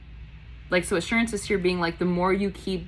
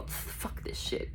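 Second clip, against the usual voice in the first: slow, drawn-out whispered curse; whispered stand-in for a scream; mock whispered outburst; 11 dB quieter, much slower than usual. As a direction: swear with no voice, stretching it out. Acts out Virgo's wish to scream.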